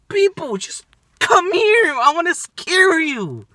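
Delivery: high-pitched plea to come closer; calling out. Real, not acted. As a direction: call them over, begging. Calling out to passers-by.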